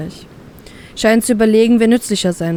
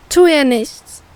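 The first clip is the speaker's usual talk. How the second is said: pleading; 6 semitones higher, slower than usual. A narrator's reading. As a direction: beg him to spare the girl. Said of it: "flehe ich ihn an".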